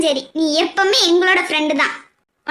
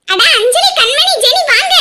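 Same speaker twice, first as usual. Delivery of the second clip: raised, high call to friends; 7 semitones higher, 3 dB louder than usual. Acted. Woman summons her friends for a photo, calling out. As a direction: call them over by name. Calls friends over to come.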